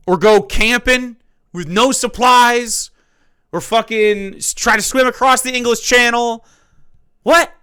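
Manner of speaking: loud, high, outraged ranting; incredulous rant. Real, not acted. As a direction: fire it off in disbelief, almost yelling. Ranting at the other host.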